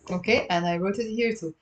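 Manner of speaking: normal talking voice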